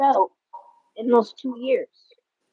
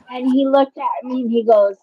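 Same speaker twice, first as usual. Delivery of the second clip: ordinary talk, nothing marked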